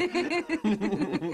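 bursts out laughing. Acted. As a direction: warm laugh at the memory. Husband laughing at shared memory.

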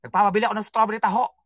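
quick, sulky imitated wife; wife scolding husband over taho; mock wife complaint. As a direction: whine it fast like the upset wife.

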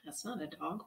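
usual speaking voice

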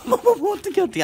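high, laughing panic; real flustered alarm as the dogs fight; panicked laughing surprise. Real, not acted. Fight breaks out suddenly.

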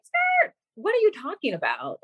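high-pitched, taken-aback retort; mock disbelief. Startled patient questioning the doctor about a tumor, mock disbelief. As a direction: act out a baffled comeback. Retold shock at tumor news.

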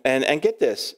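ordinary talk

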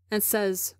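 ordinary delivery, nothing marked

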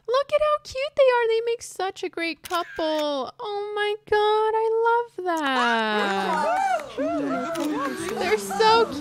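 very high, slow cooing; high delighted squeal; gushing. Real, not acted. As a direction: coo over the cute couple. Gushing over a couple.

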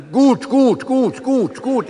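put-on dad prompting toddler on phone, high bouncy gut. Father coaching the child on phone.

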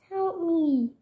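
high, unforced pretend plea; cry for help. Trapped character calls for rescue, high mock cry for help.

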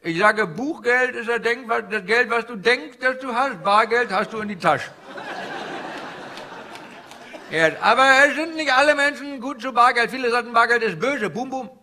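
put-on comic Indian-accented stand-up guru.

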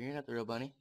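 quiet taunt to a game creature; low-key accusation. Pretend. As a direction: say it low and under control. Repeated taunt, first low take.

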